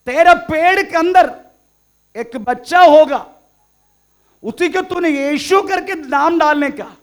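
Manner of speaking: high, booming herald's announcement, very loud; angel's proclamation. Acted. Angel Gabriel announces the child to Mary, proclaiming loudly. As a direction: proclaim it like a messenger from heaven. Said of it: "Gabriel करके एक दूध आके मेरे को कहने लगा".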